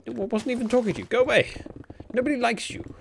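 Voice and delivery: quick, high-pitched mock telling-off; mock indignation. Streamer pretending to scold an eavesdropping Google, mock indignant. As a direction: shoo it away in playful outrage.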